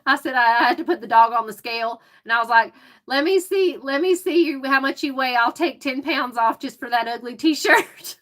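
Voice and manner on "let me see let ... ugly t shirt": teasing pet talk. Retold or imitated. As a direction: tease the dog, starting high and easing down. Retells teasing the dog at clinic.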